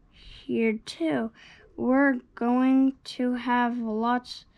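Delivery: usual speaking voice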